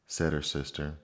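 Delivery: usual speaking voice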